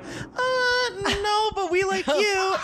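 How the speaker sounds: very high, slow mock-sweet voice; sugar-coat the rejection; hammed-up high prim pastor's wife; mocking couple. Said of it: "they're like".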